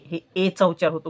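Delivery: ordinary delivery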